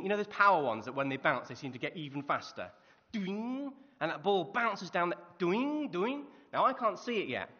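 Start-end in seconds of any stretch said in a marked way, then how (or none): 3.1-3.7 a high, springy boing like a ball hitting steps; ball bounce noise. Shown as a demonstration. Preacher imitating a power ball bouncing down temple steps, high springy boing. 5.4-6.2 a high, springy boing like a ball hitting steps; ball bounce noise. Shown as a demonstration. Preacher imitating a power ball bouncing down temple steps, high springy boing.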